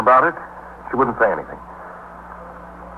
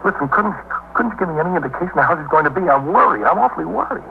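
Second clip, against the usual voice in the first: anxious pleading; 8 semitones higher than usual. Acted. Husband pleads to see wife.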